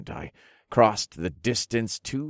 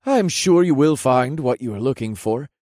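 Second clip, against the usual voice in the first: put-on raised, louder, upbeat young man; chipper lieutenant; 5 semitones higher, 5 dB louder than usual. A narrator's reading. Lieutenant reassuring the hero about the errand, upbeat. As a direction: assure him with lifted energy.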